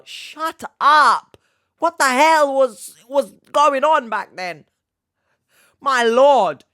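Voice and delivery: high-pitched full shout; loud, incredulous yelling; outraged disbelief. Real, not acted. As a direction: explode in comic outrage. Ranting in disbelief at school memory.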